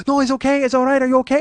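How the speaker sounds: high, sweet imitated woman; lightly voiced soothing masseuse; high falsetto reassurance; mimicking the masseuse.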